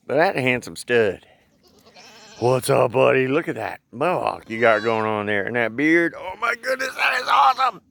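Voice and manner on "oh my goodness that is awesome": high excited exclamation. Real, not acted. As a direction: gush with delight. Excited at goat.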